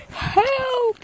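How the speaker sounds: high loud yelp of fright; scared cry for help. A narrator's reading. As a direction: squeal for help in a panic. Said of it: "said the cook".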